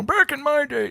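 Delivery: a hint of an old man voice; lightly voiced grumpy old man. Shown as a demonstration. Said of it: "go to my old man voice".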